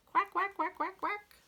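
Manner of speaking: very high duckling quacks; freed ducklings quacking. Acted. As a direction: chirp like tiny happy ducklings.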